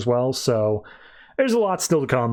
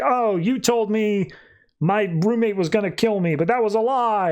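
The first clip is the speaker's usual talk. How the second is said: higher, lightly voiced wronged daughter; accusing daughter; 6 semitones higher than usual. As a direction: play a mildly hurt accusation. Rose confronts her lying father.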